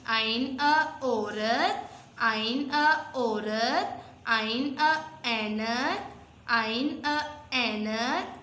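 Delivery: very slow, each syllable stretched out; slow read-along. Shown as a demonstration. Teacher leading the class in reading, very slow and drawn out. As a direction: drag out every sound for the children to copy. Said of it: "मेरे साथ मिलके पढ़ें".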